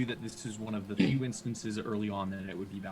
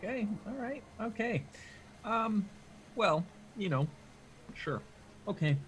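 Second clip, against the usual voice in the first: high-pitched nervous stalling; 12 semitones higher, 23 dB louder than usual. Acted. Player voicing a character trying to calm down, nervous. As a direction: nervously let it out in hesitant bits, pitched up. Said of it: "just nervously letting it all out".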